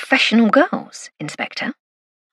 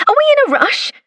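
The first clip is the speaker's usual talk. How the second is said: bright, much higher, falsely innocent; innocent question; 3 dB louder than usual. A narrator's reading. Passenger teasing the speeding inspector, mock innocent. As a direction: ask it all wide-eyed innocence. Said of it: "I asked innocently".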